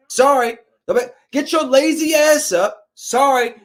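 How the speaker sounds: ordinary talk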